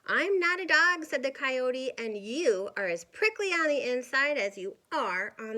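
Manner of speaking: a touch of an insulted coyote; indignant coyote. Acted. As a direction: snap back offended. Coyote answers back.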